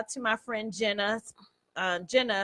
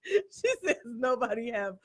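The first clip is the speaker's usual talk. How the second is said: laughing hard; 2 semitones higher, 7 dB louder than usual. Real, not acted. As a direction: shriek it out between laughs. Laughing retelling friend's joke.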